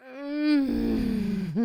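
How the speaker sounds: high, loud purring laugh; gleeful evil chuckle. A narrator's reading. Demon relishing the possession with a gleeful laugh. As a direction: savor it with a wicked laugh.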